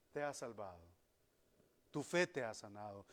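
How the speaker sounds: voz baja y suave, como una bendición; sereno y tierno. Retold or imitated. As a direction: baja la voz para confirmar la sanación con calma. Jesús responde al ciego sanado. (Jesús responde al ciego, con serena ternura)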